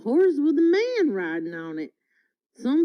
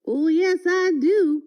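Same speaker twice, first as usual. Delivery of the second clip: higher, louder protest; cried out; 7 semitones higher, 3 dB louder than usual. A narrator's reading. Bill insists to the cowboy that he has a tail, crying out higher and louder. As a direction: cry it out in protest. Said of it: "cried Bill".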